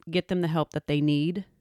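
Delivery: ordinary talk, nothing marked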